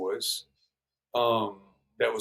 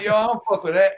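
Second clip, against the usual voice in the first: high, louder scoffing refusal; dismissive; 8 semitones higher, 7 dB louder, much faster than usual. Retold or imitated. Rapper refusing the LA call, high and scoffing. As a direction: wave off the offer, pitched up. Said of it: "I'm like".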